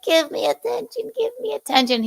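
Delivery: very high, pleading put-on voice; mock attention-seeker. Host imitating a needy girlfriend at tennis, high mock begging. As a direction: beg in a high mocking squeal.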